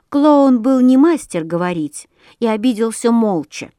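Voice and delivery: ordinary delivery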